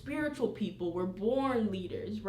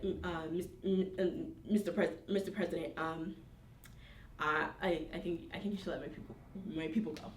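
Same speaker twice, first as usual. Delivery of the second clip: quiet, halting, unsure mumble; hesitant stammering; 3 semitones lower, 6 dB quieter than usual. Acted. Moses timidly asking the president to free his people, stammering hesitantly. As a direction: imitate someone fumbling a request, softly trailing off. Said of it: "Because how you sound going to the president like that".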